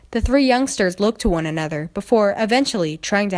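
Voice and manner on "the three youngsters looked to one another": ordinary delivery, nothing marked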